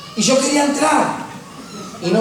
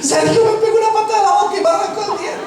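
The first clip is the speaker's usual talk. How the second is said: put-on crying little boy; 11 semitones higher than usual. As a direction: wail it like a hurt five-year-old tattling on his brother. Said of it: "salí llorando y decía". (llanto de niño chico, agudo y fuerte, acusando al hermano)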